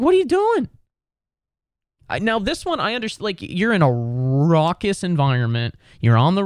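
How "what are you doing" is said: high exasperated what are you doing. Real, not acted. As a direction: throw up your hands in disbelief. Ranting about penalties.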